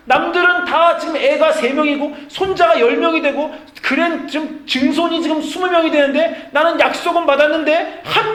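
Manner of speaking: loud, high-pitched complaint as Abraham; exasperated protest. Acted. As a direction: argue back at God in rising desperation to a shout.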